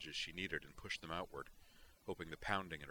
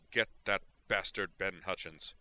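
vicious snarl, louder; 2 semitones higher, 7 dB louder than usual. A narrator's reading. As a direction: spit the name out in hate. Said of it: "he snarled".

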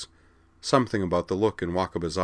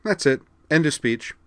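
high, louder cheery sign-off; pleasant; 9 semitones higher, 5 dB louder than usual. A narrator's reading. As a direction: wrap up pleasantly, pitched well up. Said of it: "then added pleasantly".

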